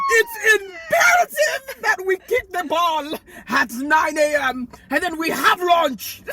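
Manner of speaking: put-on slow, loud Nigerian accent, laughing through it. Eight-year-old planning a playground date, Nigerian accent. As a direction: drag it out in a thick Nigerian accent. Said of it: "safe American accent".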